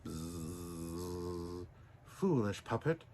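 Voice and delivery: a soft cricket's scolding buzz. Acted. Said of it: "buzzed a cricket".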